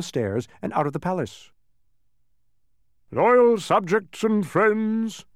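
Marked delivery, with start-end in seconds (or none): 3.1-5.2 high, loud, slow proclamation; drawn-out kingly speech opening; royal address. A narrator's reading. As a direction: orate to a great hall. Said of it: "his voice echoing".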